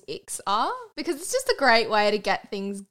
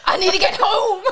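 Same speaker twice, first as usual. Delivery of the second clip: panicked plea; 9 semitones higher, 6 dB louder than usual. Retold or imitated. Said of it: "you're like".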